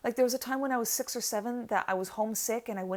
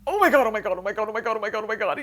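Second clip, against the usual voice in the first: panicked repeating; 2 semitones higher, 9 dB louder, much faster than usual. Retold or imitated. Trapped in her car by a stranger, frantic loud panic. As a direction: rattle it off in rising panic, then ease down.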